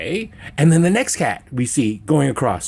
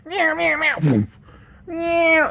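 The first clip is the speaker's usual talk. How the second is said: much higher rapid mock meows; high squeaky repeated meowing; string of meows; much slower than usual. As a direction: yowl like a spinning cat. Cat spinning round table.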